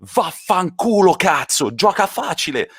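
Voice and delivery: furious manager on the touchline; Conte swearing in Italian. Retold or imitated. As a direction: bark it at the player in Italian. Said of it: "And Conte says".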